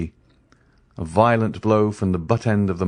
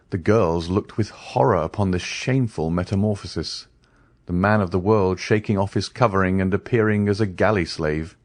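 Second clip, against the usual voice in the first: ordinary delivery, nothing marked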